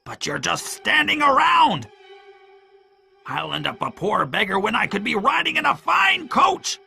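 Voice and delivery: high-pitched raging villain; furious shouting. A narrator's reading. Pew raging at men standing around, shouting. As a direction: rail at them in a high shout. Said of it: "Pew shouted".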